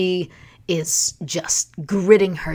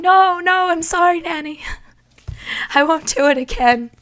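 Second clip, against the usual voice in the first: sheepish apology; 7 semitones higher, faster than usual. Retold or imitated. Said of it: "And I'm like".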